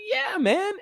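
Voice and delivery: high, loud burst of agreement; eager yes. Retold or imitated. Retold reply to friend's question.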